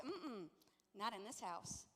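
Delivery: soft, low mom's veto; quietly final, closing the matter; firm refusal. Retold or imitated. Mom refusing son's plea, quietly firm. Voices herself refusing again.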